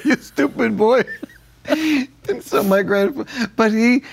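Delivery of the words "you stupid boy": higher, laughing mock Italian grandfather scolding; a laughing imitation of his grandfather yelling at him. Telling his cohost how grandpa yelled, laughing mock scold. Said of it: "He said".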